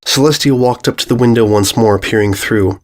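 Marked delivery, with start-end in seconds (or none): none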